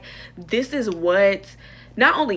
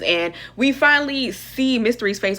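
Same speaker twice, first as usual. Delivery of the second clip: normal talking voice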